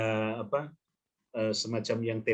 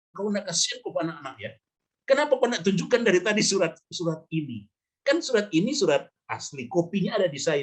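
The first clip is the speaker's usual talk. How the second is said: angry officer; 6 semitones higher than usual. Retold or imitated. Immigration officer scolding the young traveller, mock angry. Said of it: "Oh, dia marah".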